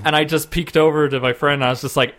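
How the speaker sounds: usual speaking voice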